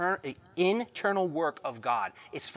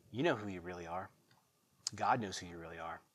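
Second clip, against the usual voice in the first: hushed; 9 semitones lower, 11 dB quieter than usual. Real, not acted. Quiet personal truth.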